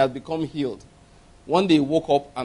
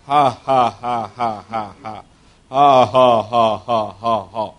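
low, dry, deliberate ha ha; a lower dry mock laugh; dry forced laugh; 9 semitones lower, much slower than usual. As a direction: laugh on purpose without any joy. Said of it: "He said the laughter sounded very dry".

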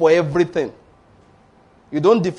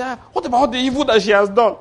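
accusing Satan; 6 semitones higher than usual. Retold or imitated. Satan accusing before God.

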